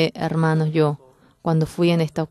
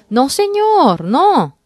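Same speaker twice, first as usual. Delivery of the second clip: a high, loud, pleading cry; anguished cry; 9 semitones higher, 8 dB louder, slower than usual. Acted. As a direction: beg desperately, voice flying up. Pedro pleads in his heart.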